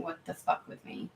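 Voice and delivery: whispered, hushed imitation of the guides; guides' mock whisper. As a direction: breathe the line like a hushed aside. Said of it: "both are walking around going".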